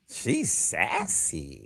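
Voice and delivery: slow, low teasing verdict; slow, low mocking diagnosis. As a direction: drag the words out to tease her. Mock verdict on the therapist.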